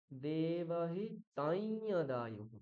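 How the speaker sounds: দেবহিতাই যদায়ুঃ slowly with a nasal ai before যদায়ুঃ; slow model reading, nasal glide; correct reading. Shown as a demonstration. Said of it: "মনে থাকবে".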